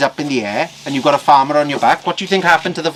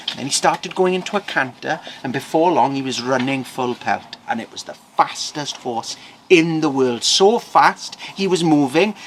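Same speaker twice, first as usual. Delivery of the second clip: plain reading voice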